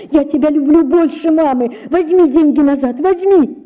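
высоко и громко, отчаянно; умолять его вернуться; отчаянный крик. A narrator's reading. Девочка кричит вслед отцу. (дочь кричит вслед отцу, высоко и отчаянно)

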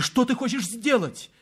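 high-pitched alarmed question; scared woman's high appeal; frightened. A narrator's reading. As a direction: ask it in sudden fright. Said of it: "сказала она в испуге".

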